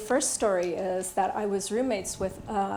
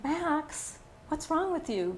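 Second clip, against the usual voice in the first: retold yelling; 7 semitones higher, 3 dB quieter, slower than usual. Mother at nine-year-old son over math homework, mild mock yell. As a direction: snap at him in a pitched-up but unforced voice. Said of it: "I started yelling at him".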